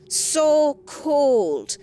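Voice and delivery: ordinary delivery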